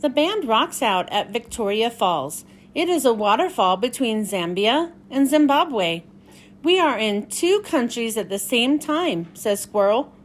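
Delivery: bright, lively picture-book read-aloud; reading to children. Acted. Miss Michelle reading Pete the Cat to young viewers, lively story-time voice.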